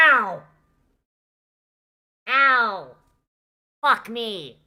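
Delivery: loud pained yelps after a cough; sudden pain. Real, not acted. Streamer hurting himself live, loud pained yelps. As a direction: yell out in real pain, ow ow.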